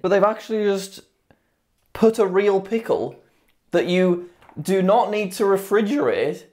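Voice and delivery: ordinary talk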